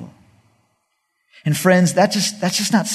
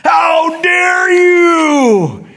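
slow, drawn-out furious shout, higher; angry yell; 7 dB louder, much slower than usual. Retold or imitated. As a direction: bellow at the rebels. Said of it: "he starts yelling at them".

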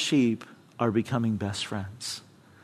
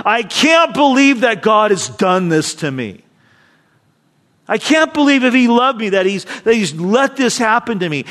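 indignant, raised, hurt protest against God; bitter complaint; 6 semitones higher, 5 dB louder than usual. Retold or imitated. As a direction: voice the offended believer, a little hammed-up. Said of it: "will say something like this".

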